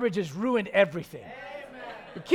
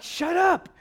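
higher, half-joking shut up; mock annoyed; 6 semitones higher than usual. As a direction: snap it playfully at Paul. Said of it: "I'm like".

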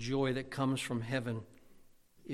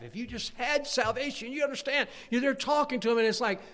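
higher, earnest sermon voice; fervent preaching; 12 semitones higher, 6 dB louder than usual. Acted. Preacher imagining talking to lost people at the door, fervent. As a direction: press the point with urgency.

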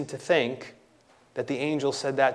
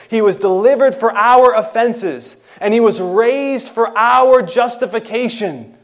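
higher and louder sermon climax; full-force preaching; 8 semitones higher, 11 dB louder than usual. Acted. Preacher building to a climax before the congregation, loud and high. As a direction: build to a ringing proclamation.

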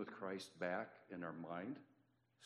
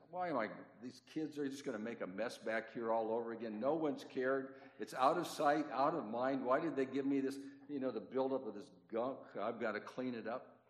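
louder, higher exasperated complaint; grumping away; 4 semitones higher, 5 dB louder than usual. Retold or imitated. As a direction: gripe emphatically while stuck with a dirty chore. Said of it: "I'm back there grumping away".

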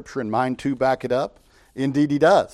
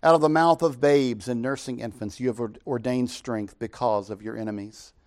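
scripture reading; 4 dB louder than usual. Acted. Preacher reads Psalm eight to the congregation, solemn and proclaiming.

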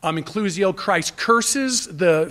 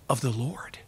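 breathy hushed whisper in awe; awed whisper; 7 semitones lower, 11 dB quieter than usual. Acted. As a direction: whisper it like a holy secret. Pilgrim's awed longing to worship.

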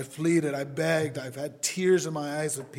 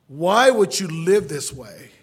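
very loud, slow cry of appeal; impassioned pleading; 3 semitones higher, 12 dB louder, slower than usual. Retold or imitated. Preacher begging his brother over and over, loud desperate pleading. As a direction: belt out the plea, drawn out. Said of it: "I've pleaded with my whole family".

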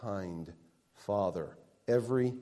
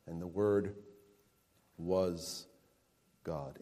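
each word set apart, dropping softer to "God"; slow emphatic reading; 6 semitones lower, 8 dB quieter, slower than usual. Shown as a demonstration. Pastor stressing the verse's core claim, slow emphatic reading. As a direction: lay "And the Word was God" down slowly, word by word. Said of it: "position of emphasis".

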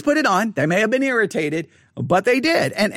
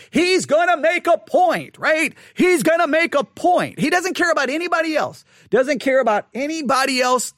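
raised pitch, slowed, almost shouting; venting at the man who won't mask; indignant outburst; 7 semitones higher than usual. Real, not acted. Indignant rant about the maskless man.

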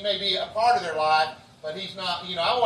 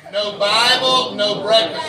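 slow, loud slogan for the congregation to echo; loud call and response; 9 dB louder, slower than usual. Shown as a demonstration. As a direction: drive it home to the church. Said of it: "Say that with me".

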